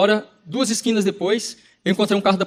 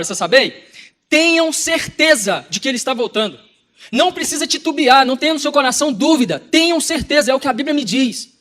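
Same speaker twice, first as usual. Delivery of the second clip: raised, forceful preacher's delivery; emphatic preaching; 3 semitones higher than usual. Real, not acted. Preacher warning listeners that Jesus is returning, emphatic preaching. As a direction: hammer out the key words with conviction.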